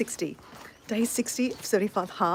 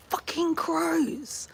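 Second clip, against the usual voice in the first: higher, long drawn-out curse; exasperated swearing; 8 semitones higher, much slower than usual. Real, not acted. Chicken owner cursing egg thieves, exasperated and drawn out.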